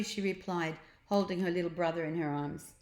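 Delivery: plain reading voice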